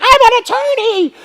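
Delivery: very high, slow, loud mock scream; imitated frantic yell. Lawyer pulled from the car by police, imitated frantic yell. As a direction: shriek it like a lawyer being dragged out.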